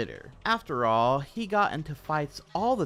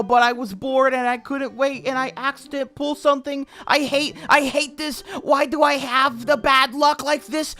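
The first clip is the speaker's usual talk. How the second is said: very high, loud furious whine; mock P L screaming at the devs; mocking ranting gamer; 14 semitones higher, 11 dB louder than usual. Narrator reading P L's rant at Dina, mock high furious whine.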